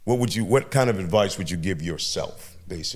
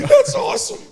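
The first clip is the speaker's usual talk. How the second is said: real laughter through the words, high and loud; high-pitched, loud laughing delivery; 4 dB louder than usual. Real, not acted. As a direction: crack up while saying it. Cracking up at the choice.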